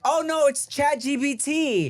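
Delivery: high, drawn-out fake innocence; hammed-up guilty excuse; caught cheater covering up.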